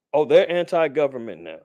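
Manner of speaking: ordinary talk, nothing marked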